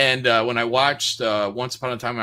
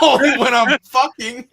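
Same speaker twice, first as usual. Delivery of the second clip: laughing retort; 12 semitones higher, 10 dB louder than usual. Pretend.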